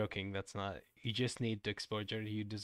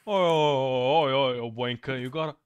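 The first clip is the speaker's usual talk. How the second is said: mock British accent; 3 semitones higher, 12 dB louder than usual. Streamer heckling in the game, loud mock British accent. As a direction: bellow it like a cartoon British lout. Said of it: "I'm British now".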